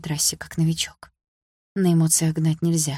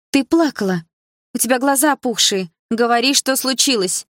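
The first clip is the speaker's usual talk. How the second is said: higher, louder, commanding; insistent friend; 7 semitones higher, 4 dB louder than usual. A narrator's reading. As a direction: order her to tell the truth. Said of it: "велела Дилара". (Дилара требует ответа, настойчиво и громко)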